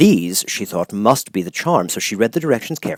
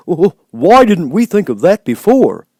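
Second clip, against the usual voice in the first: louder, higher eager scarecrow; eager cry; 11 semitones higher, 8 dB louder than usual. A narrator's reading. Scarecrow cheering the idea, loud eager cry. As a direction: cry it out, delighted. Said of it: "cried the Scarecrow".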